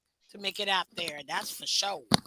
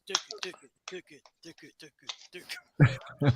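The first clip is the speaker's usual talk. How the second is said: Nigerian accent; 8 semitones lower, 7 dB quieter than usual. Shown as a demonstration. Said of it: "like doing the Nigerian voice".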